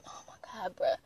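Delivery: very quiet, under the breath; exasperated aside. Retold or imitated. Granddaughter reacting to grandmother's disapproval, exasperated under the breath. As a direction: mutter it in weary disbelief.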